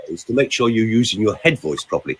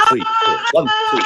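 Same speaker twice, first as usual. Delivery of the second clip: much higher counting in head voice. Shown as a demonstration. Coach demonstrating head voice, counting much higher. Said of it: "high sound, head voice".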